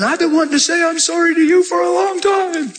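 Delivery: high, tearful confession; bawling apology. Retold or imitated. Husband bawling his apology to his wife: high tearful pleading. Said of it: "Now I'm bawling".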